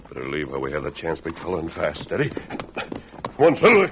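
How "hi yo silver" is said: high, loud heroic call; rallying cry. Acted. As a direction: ring it out to the horse. Rider's rallying cry to horse.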